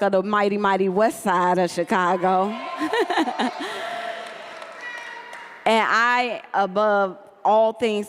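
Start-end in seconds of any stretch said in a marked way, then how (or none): 2.6-5.4 high airy laugh; amused laugh at her own line; light laugh. Real, not acted. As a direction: let a soft laugh out. Laughs after West Side line.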